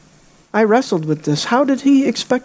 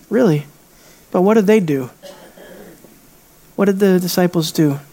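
ordinary talk, nothing marked

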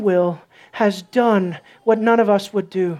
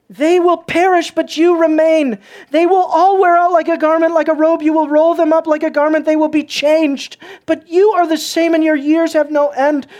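raised, ringing scripture reading; preached scripture; 4 semitones higher, 3 dB louder than usual. Acted. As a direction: proclaim the verses to the church.

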